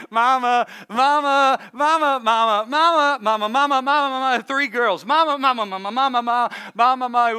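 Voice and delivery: put-on kids whining mama. Three girls pestering their mother, nagging mama chant. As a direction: play the kids calling mama nonstop. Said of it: "Any mamas can relate".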